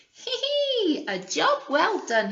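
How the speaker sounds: high giggling piglet, pleased with himself; pleased little pig. Acted. Teacher voices the proud second pig, high and giggly.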